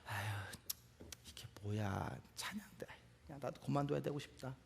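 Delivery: very soft, low grumble to oneself; acted private complaint, barely voiced; muttered grumbling. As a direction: mutter it wearily under your breath. Acts choir member grumbling to self.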